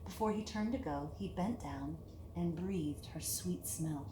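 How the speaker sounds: usual speaking voice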